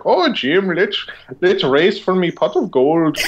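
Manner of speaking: high Irish leprechaun voice; squeaky stage-Irish leprechaun. Retold or imitated. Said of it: "And he was like".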